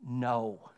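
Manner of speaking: no, dropped low and landed firmly; emphatic no. Shown as a demonstration. The congregation answers Jesus's question with a firm no. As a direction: answer with a flat, final no. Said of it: "answer with an emphatic".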